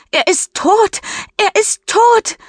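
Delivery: very high, loud, despairing wail; crying scream. Acted. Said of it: "Mischa weint und schreit". (weinend schreien)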